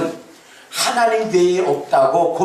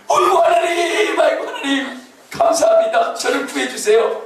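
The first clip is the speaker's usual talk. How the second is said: mock overjoyed relief; 9 semitones higher than usual. Rescued deacon thanking God, excited mock relief. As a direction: gush with grateful relief. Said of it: "그랬더니".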